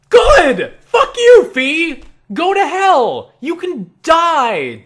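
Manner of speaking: very high, full-volume yelling; furious shout. Acted. Player yelling good riddance at departing companion, furious shout. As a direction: scream it gleefully rude, stretching the last words. Answers Fi's acted farewell by yelling.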